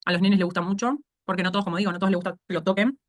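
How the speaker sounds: ordinary delivery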